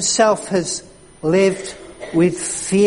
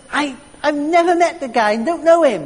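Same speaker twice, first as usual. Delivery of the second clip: heated denial; 8 semitones higher, 4 dB louder than usual. Retold or imitated. Peter swears denial.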